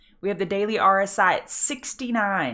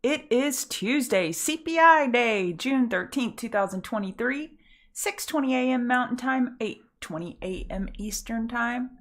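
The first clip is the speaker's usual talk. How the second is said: show host intro; 4 semitones higher than usual. Acted. Opening the morning trading show.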